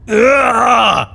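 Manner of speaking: a sharp fed-up shout; frustrated ugh. Acted. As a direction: let out an exasperated ugh. Frustrated outburst, cry shout.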